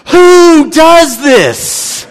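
high, loud, very slow who does this; high loud mock outrage; incredulous. Real, not acted. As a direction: stretch it out in disbelief. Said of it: "incredulous punchline".

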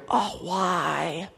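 soft, far-off lament of why; mock despair at the passage; quiet sighing why; dismayed groan. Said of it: "you just kinda go".

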